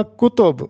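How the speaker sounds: کتب said as "kutub", u after both k and t; کتب spoken with two short u vowels, "ku-tub"; teacher models the plural کتب; correct pronunciation. Shown as a demonstration. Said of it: "تو یہاں پر تلفظ ہوگا".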